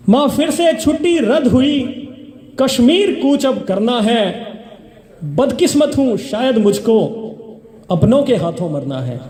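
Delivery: measured, rhythmic reciting of verse; stage poetry reading; poem recitation. Acted. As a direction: declaim each line to the audience, letting it fall. Recites poem, repeating lines.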